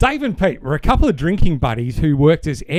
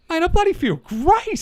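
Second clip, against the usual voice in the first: much higher, chirpy Aussie bloke; telling his mate he feels great, much higher and chirpy; 13 semitones higher than usual. Retold or imitated. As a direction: pitch Dave up, pleased with himself. Dave voiced in the dad joke.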